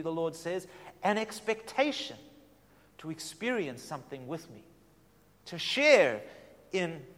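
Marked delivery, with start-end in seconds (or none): none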